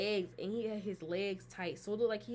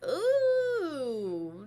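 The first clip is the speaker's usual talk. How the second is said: long, high, loud "ew" of revulsion; drawn-out grossed-out wail; disgusted squeal; 7 semitones higher, 5 dB louder than usual. Retold or imitated. Recoils at how gross it looked.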